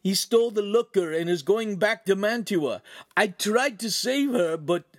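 high, loud, panicked report; frantic babble. A narrator's reading. Bleeding Ugolino telling friends of the attack, frantic babbling. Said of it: "began to babble so quickly he was incoherent".

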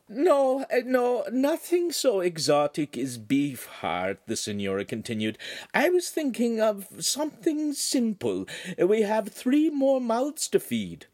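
a refined lady politely declining; signora's voice. Acted.

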